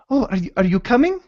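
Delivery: a bright, hurried woman's voice asking her coworker; eager barmaid. Acted. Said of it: "woman behind the bar".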